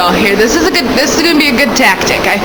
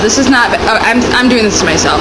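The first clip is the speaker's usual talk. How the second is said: ordinary talk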